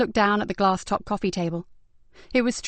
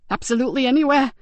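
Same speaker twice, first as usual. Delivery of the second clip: lightly raised voice of a shaken, disgusted woman; Zoe, shaken victim; 5 semitones higher, 4 dB louder than usual. Acted. Victim describing her attacker's smell to Ben, mildly disgusted. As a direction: recall the smell with a touch of revulsion.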